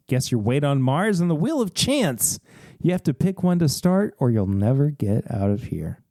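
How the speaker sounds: ordinary talk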